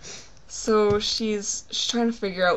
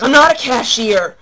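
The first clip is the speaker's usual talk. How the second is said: loud, higher-pitched exasperated protest; mock furious yell; angry outburst; 4 semitones higher, 13 dB louder than usual. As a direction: imitate a fed-up clerk blowing up. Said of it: "she smashes the keypad again".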